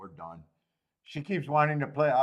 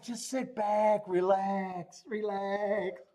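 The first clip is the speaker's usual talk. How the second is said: lightly voiced wife, higher, coaxing; mock soothing wife; 5 semitones higher, 4 dB quieter, much slower than usual. Imitating his wife calming him about travel, mock soothing. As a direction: coo it like she's calming him down. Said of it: "She's like".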